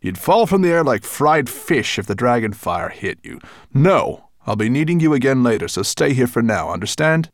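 commanding dragon. A narrator's reading. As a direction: order the servant firmly, pushing up on the final question. Dragon orders raven to stay.

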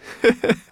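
high, loud, pleased cackle; self-satisfied creature laugh; gloating laugh. Acted. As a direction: chuckle with flattered glee. Flattered brownie gloats.

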